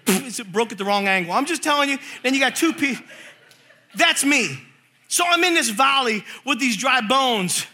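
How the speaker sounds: ordinary delivery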